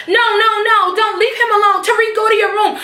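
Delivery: high, frantic mother voice; mock Tasha. Tasha pulling Tariq away from his father, high and frantic. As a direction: plead high like a panicked mom.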